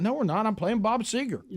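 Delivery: high-pitched, confused protest; insistent denial. Retold or imitated. Sleepy DJ answers caller about being off air, insistent denial. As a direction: protest it high, sure you're right.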